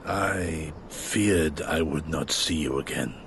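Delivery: low, soft and slow; hushed and halting, voice dropped low; quiet heartfelt confession. Acted. Elder to a former pupil after long absence, quietly heartfelt.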